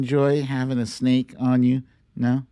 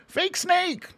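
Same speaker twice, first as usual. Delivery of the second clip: gleeful shout. Real, not acted. Gleeful punchline.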